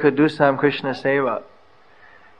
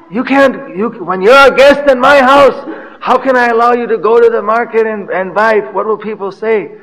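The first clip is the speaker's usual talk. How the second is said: high, loud mock protest from a host; excited; 7 semitones higher, 5 dB louder than usual. Host forbidding guest to shop at market, excited. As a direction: act out an indignant refusal, pitch jumping up. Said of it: "I get all excited".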